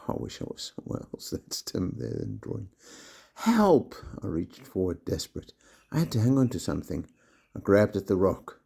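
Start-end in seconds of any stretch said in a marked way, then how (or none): 3.3-3.9 very high panicked cry; frightened cry for help. A narrator's reading. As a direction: cry out as you slip. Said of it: "I screamed".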